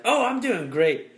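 high, loud, stretched-out fake enthusiasm; mock cheerful. Acquaintance answering how they are doing, mock cheerful. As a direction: gush it, hammed-up.

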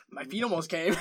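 repeating it through laughter; amused laughing repeat. Real, not acted. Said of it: "Don't pause there".

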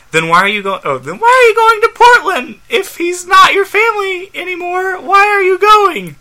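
shrieky high character voice, hammed-up. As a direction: pitch it way up, upset and breaking down. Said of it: "turn up my voice a little bit higher".

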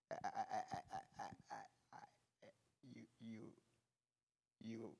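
stammering near-whisper, fading almost to nothing; feeble, sheepish voice. Acted. Peter answering Jesus before the disciples, feeble and sheepish. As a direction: barely get the words out, terrified to answer. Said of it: "with a very feeble, sheepish voice".

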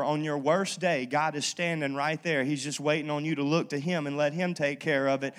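ordinary talk, nothing marked

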